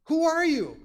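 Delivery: high, loud startled question; startled demand. Acted. Boaz, woken in the dark, challenges the stranger, startled. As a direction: call out as if jolted awake.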